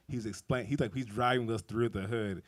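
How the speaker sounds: usual speaking voice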